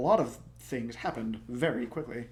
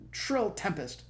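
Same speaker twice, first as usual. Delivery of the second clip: plain reading voice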